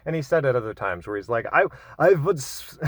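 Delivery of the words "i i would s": lightly put-on Slovenian accent, higher and louder; Zizek voice. Vlogger begins quoting Zizek, a hint of his accent. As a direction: start imitating Zizek, then stop. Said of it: "I am not gonna do his accent".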